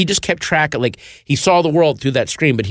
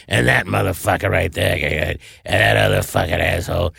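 Lawrence Tierney dishing; 4 semitones lower than usual. Retold or imitated. Actor dishing on everyone in the film, low mock grumble. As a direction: grumble insults like a cranky screen heckler. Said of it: "him just dishing on everyone".

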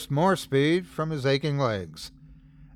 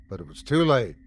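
ordinary delivery, nothing marked

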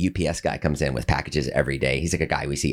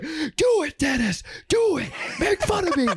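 begging shout. Retold or imitated. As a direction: yell it, begging to be mocked. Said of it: "Like that".

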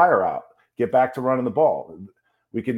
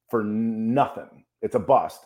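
ordinary talk, nothing marked

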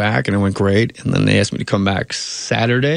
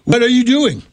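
much higher, pushy impression of Harvey Keitel; mock Harvey Keitel; 12 semitones higher than usual. Pitching himself door to door, a hammed-up Harvey Keitel. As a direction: barge in office to office as Harvey Keitel. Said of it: "go, like, a door-to-door salesman and go".

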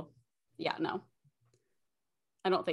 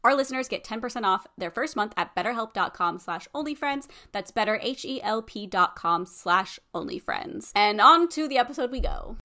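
upbeat, even sponsor read; podcast ad read. Real, not acted. Host reading a sponsor message to listeners, podcast ad read. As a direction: read the sponsor copy smoothly and clearly.

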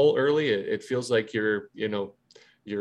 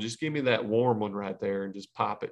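a hint of a Southern twang, lightly put on; casual beer order in a light Louisiana accent; Aaron Loop Southern twang. Imitating a teammate's Louisiana accent for his cohost, lightly done Southern twang. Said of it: "I heard a little Southern twang there".

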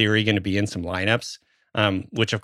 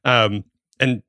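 ordinary talk, nothing marked

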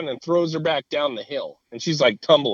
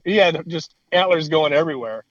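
normal talking voice

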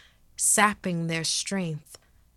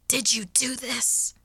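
higher-pitched hoarse accusation; hoarse snap; 7 semitones higher than usual. A narrator's reading. Girl confronting the ghost over her parents, snapping hoarsely. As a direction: snap it through a sore throat. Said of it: "I snapped hoarsely".

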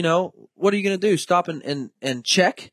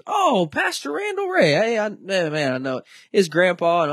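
cheery game warden; 6 semitones higher than usual. Retold or imitated. Warden recognizes the family name.

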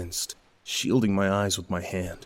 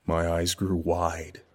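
plain reading voice

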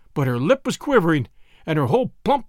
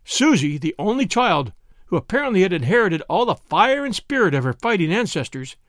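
plain reading voice

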